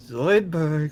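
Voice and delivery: drawn-out old-man impression. Co-host mocks how a character says his name, old-man voice. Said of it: "say it like the old men from Achievement Hunter".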